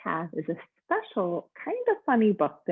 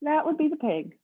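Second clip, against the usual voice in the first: bellhop voice; 9 semitones higher than usual. Acted. Bellhop welcoming Mister Snore, high and cheery. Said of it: "said the bellhop".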